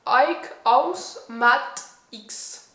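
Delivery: slow, careful French-accented English brand name; awkward English accent on a product name; attempted English accent. Real, not acted. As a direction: sound out the English name the way a French speaker does. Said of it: "désolé pour mon anglais".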